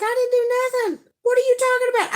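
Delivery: very high, protesting imitation; defensive Wayne. Wayne denies touching the phone, high mock defensiveness. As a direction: squeal out a mock innocent denial. Acted reply from accused Wayne.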